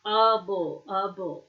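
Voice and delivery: "able" isolated as a word ending, UH-bul; "able" repeated as a separate piece for learners; syllable demo. Shown as a demonstration. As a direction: model the ending "able" with a reduced vowel. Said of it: "Now it's pronounced comfort".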